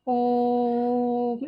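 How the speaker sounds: ओम with the ओ sustained very long on one level note; very long drawn-out vowel. Shown as a demonstration. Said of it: "इसको हम लंबा खींचकर बोलते हैं".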